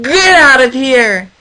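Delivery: slow, loud get out; angry goat shout. Acted. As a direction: drive him off with a bleat. Goat shouting the hippo away.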